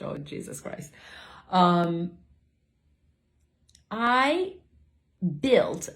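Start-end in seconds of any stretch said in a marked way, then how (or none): 0.0-0.9 softer, whispered exasperation; whispered aside. Real, not acted. To herself about her tangent, a whispered groan. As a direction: sigh it under your breath.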